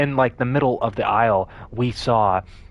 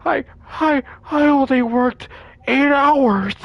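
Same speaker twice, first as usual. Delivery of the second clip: much higher, louder squeaky excuse; panicky friend; 13 semitones higher, 3 dB louder than usual. Retold or imitated. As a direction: squeal it out in fright. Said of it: "Froderick, being Froderick, he was like".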